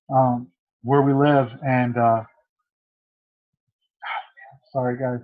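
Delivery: holding back tears, voice catching with long pauses; fighting through real emotion; choked up. Real, not acted. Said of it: "I don't know why I'm getting emotional now".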